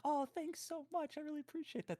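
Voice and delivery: high, sweet thank-you voice; put-on grateful gushing; gushing mock gratitude. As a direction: flatter him in a high, softened voice. Acting a grateful woman.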